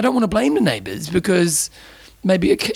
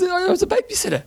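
much higher mock-indignant cry; mock outrage. Real, not acted. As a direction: pitch it up, hammed-up offence. Mock outrage at being babysitter.

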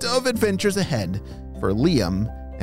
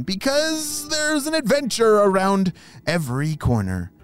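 put-on high, bright goodbye to children; cheery kids host; 11 semitones higher than usual.

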